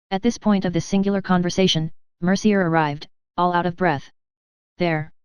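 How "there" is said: gloomy. Acted. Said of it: "he said, in a gloomy voice".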